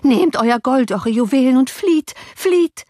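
high, alarmed cry, climbing to the end; urgent plea. A narrator's reading. Nurse urging master to escape, urgent plea. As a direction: beg him to escape, rising in pitch.

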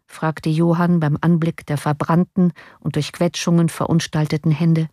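plain reading voice

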